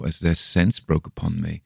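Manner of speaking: plain reading voice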